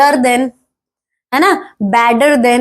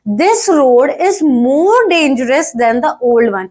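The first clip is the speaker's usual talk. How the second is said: slow example reading; much slower than usual. Shown as a demonstration. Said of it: "reading example slowly".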